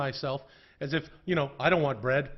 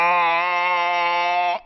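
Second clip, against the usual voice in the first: loud, long walrus bellow; bull walrus sound; 4 semitones higher, 11 dB louder than usual. Shown as a demonstration. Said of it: "I make sort of a bull walrus sound".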